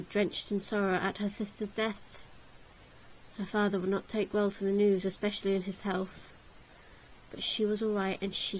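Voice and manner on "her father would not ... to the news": usual speaking voice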